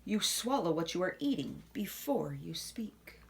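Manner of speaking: unvoiced soft whisper. Whispering the manners answer to children. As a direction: hush it like a secret.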